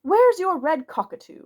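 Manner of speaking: suddenly cries out to Barker; sudden outburst. A narrator's reading. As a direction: blurt it out at Barker all at once. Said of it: "said Oberon Quinn suddenly".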